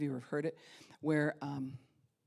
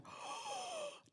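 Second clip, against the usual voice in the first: very high startled gasp; a sudden gasp; sharp gasp; 8 dB quieter than usual. Retold or imitated. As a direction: gasp in shock mid-run. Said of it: "I'm like".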